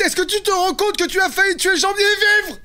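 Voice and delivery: high-pitched, incensed reprimand; indignant scolding. Acted. Scolding Ralph for nearly killing the actor, indignant.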